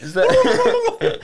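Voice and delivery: high squeaky laugh breaking the words. Real, not acted. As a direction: laugh through the question. Laughing after the bugle imitation.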